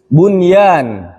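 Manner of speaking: "bunyan" with a distinct n before the y; clear nun. Shown as a demonstration. Teacher giving another example to students, clear nun. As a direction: keep the n unmerged in "bunyan". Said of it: "Tidak dibaca buyan".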